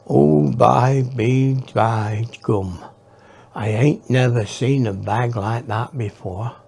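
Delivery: put-on a light Cornish kid marvelling at the bag; Cornish accent. Boy marvels at a strange bag, slow Cornish accent. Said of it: "in a sort of Cornish accent".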